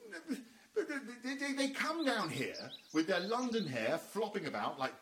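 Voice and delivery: fast, high, spluttering with laughter; flustered laughing rant. Acted. Posh husband ranting about London visitors. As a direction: sputter it out, amused and indignant.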